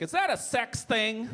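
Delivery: high, slowed mom voice. Acted. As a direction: ask it high and drawn out, like a scandalised mother. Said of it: "And she's like".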